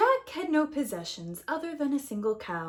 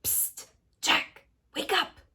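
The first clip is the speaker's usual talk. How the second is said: whispered psst then a higher wake-up call; 8 semitones higher than usual. Acted. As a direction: whisper him awake. Narrator wakes sleeping Jack.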